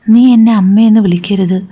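ordinary delivery, nothing marked

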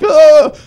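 loud, high-pitched "ugh"; exasperated groan. Retold or imitated. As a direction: groan in comic frustration. Acted exasperated reply to GPS.

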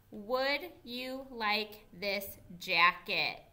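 teacher reading along slowly with the class; slow choral reading. Shown as a demonstration. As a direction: read it slowly together. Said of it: "Can you read it with me".

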